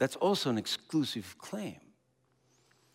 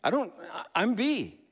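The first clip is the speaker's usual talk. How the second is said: louder, higher flustered denial; indignant protest; 7 semitones higher, 3 dB louder than usual. Retold or imitated. B's people protesting they don't agree, raised and flustered. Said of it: "The people in B say".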